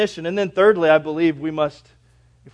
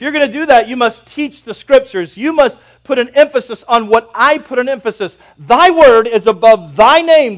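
loud, raised pulpit delivery; full-force preaching; 6 semitones higher, 12 dB louder than usual. Real, not acted.

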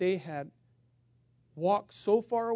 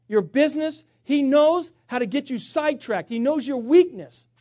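loud, high, driving sermon delivery; full-force preaching; 7 semitones higher, 9 dB louder than usual. Acted. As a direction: preach it hard, pounding every phrase.

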